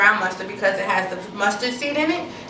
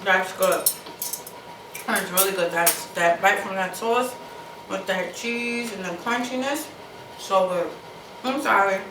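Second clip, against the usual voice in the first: mouth full; 3 semitones lower, 4 dB quieter than usual. Real, not acted. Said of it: "I'm sorry for talking with my mouth full".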